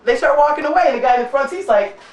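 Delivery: ordinary talk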